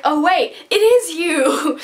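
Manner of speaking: playful put-on recognition, stretched out; mock surprised realisation. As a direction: act out a teasing, slow 'found you'. Voices Kenji mockingly spotting his friend.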